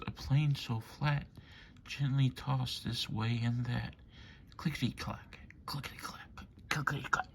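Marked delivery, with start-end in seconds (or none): 4.6-7.3 soft clickety clack. Acted. Wheels sound, softly.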